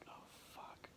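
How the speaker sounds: barely audible frightened whisper; scared whisper. Retold or imitated. Man facing an advancing stranger whispers in fear. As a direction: breathe it out in terror, almost no voice. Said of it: "I was scared".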